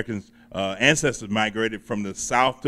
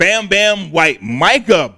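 hype intro; 5 semitones higher, 7 dB louder, much slower than usual. Acted. Host hyping guest's stage name.

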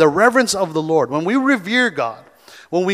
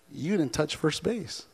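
calm umpire; 13 dB quieter than usual. Retold or imitated. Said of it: "the umpire said".

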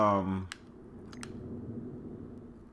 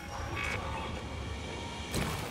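gasp; 10 dB quieter than usual. Real, not acted. Scared by a creature.

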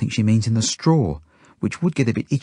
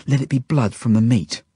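plain reading voice

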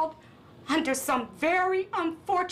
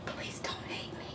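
breathless whispered plea; pleading whisper; 13 dB quieter than usual. Acted. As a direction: beg him, barely audible. Guardian begs in tears.

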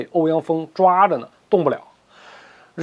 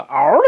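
imitated yelp; 4 semitones lower, 4 dB louder than usual. Narrator mimicking Ouyang Ke being grabbed, imitated yelp. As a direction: yelp like a grabbed poodle, briefly.

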